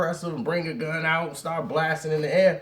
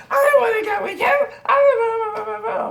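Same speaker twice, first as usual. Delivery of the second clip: shrill high screaming protest, much higher and louder; yelling; 5 dB louder, faster than usual. Retold or imitated. Upset girl yelling she won't go with him. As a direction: scream it like a girl who refuses to leave. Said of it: "still yelling".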